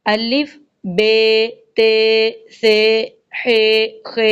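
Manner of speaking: letters named the Urdu way, alif be te; Urdu letter names. Shown as a demonstration. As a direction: say الف بے تے in Urdu style. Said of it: "Urdu کا لہجہ". (اردو لہجے میں حروف)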